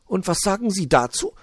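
high magpie voice, conspiratorial; gossiping magpie. Acted. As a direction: share a suspicion in secret. Magpie presses again.

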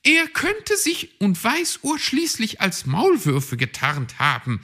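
brusque rebuff. A narrator's reading. Magpie rebuffs the receptionist, brusque. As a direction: slap the objection down. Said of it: "schmetterte ... wirsch ab".

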